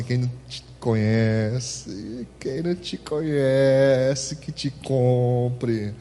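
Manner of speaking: slow knowing saying. Retold or imitated. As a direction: spell it out teasingly slowly. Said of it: "Olha pro teu irmão e fala assim".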